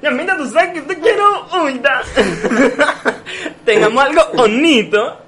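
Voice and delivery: high, laughing lovesick plea; mock lovesick pleading. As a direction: beg her sweetly, half laughing. Pleading to Kendall mockingly.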